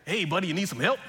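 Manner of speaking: put-on mild Southern accent offering help. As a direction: ask it like a friendly Southern good old boy. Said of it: "stick with this accent".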